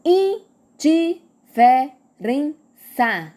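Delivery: "Indiferença" slowly split as in, di, fe, ren, ça; five spaced beats in "Indiferença"; syllable by syllable. Shown as a demonstration. Teacher showing five syllables, "Indiferença" said in separated syllables. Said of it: "cinco sílabas".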